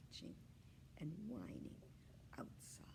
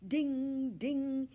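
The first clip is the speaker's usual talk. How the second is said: high, loud bell imitation; bell ding; 8 semitones higher, 12 dB louder than usual. Bell on the hamster wheel, high ding. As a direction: ring out like a little bell. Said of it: "Each time the bell rang".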